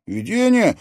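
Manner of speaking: loud, high, amazed echo; sharp burst of surprise; astonished exclamation. A narrator's reading. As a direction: exclaim it in disbelief. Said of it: "воскликнул Алекс".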